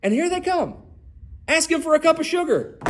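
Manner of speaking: exasperated. Acted. Preacher voicing Isaac's exasperation.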